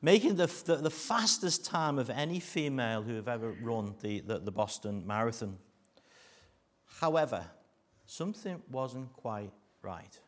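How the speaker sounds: normal talking voice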